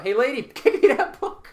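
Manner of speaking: loud, high-pitched comic yell; hammed-up shout with a laugh. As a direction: call out loudly to someone across the room. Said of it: "can I yell out".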